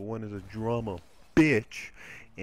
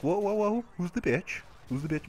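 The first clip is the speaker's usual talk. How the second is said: retold alarm; 6 semitones higher than usual. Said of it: "I'm like".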